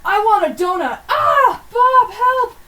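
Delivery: put-on high, loud, shouty kid voice; excited child yelling through a letter; hammed-up kid letter. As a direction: read it like a wild ten-year-old wrote it. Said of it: "written by ten-year-olds".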